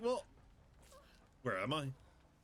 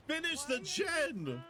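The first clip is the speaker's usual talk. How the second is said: yelled order; 11 semitones higher, slower than usual. Real, not acted. Yelling at teammate Yui.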